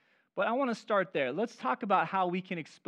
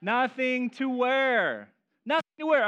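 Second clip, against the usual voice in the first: 1 mock disbelief; 6 semitones higher, 6 dB louder, slower than usual. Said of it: "you opened the closet and you said".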